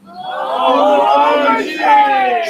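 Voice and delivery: loud shouted reply; crowd shout. Real, not acted. Crowd answering the poem's cue, loud shout. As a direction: shout it back.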